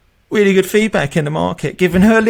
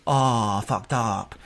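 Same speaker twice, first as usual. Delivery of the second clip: low, much softer sheepish groan; sleepy low admission; groggy mumble; 7 semitones lower, 6 dB quieter, much slower than usual. Retold or imitated. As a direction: mumble it like you just woke up. Groggy candidate admits oversleeping.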